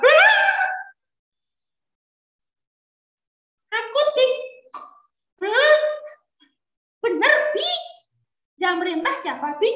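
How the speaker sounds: put-on high, curious child's voice; a boy pestering his father with questions. Young Ismail asking his father about the dream in a high child voice.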